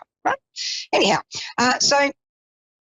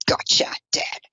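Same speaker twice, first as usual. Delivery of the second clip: whispered tease. Retold or imitated. Teasing her stepdad through telepathy, whispered. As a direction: breathe it out like a secret joke.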